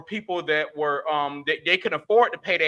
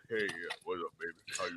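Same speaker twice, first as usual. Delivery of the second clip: much lower, much softer smooth date voice; deep seductive bass, lower and softer; put-on deep voice; 11 semitones lower, 16 dB quieter than usual. Shown as a demonstration. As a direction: drop it low and smooth to flirt. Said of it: "make my voice deeper in the date".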